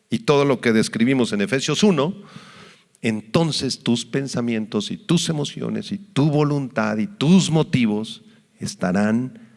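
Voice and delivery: usual speaking voice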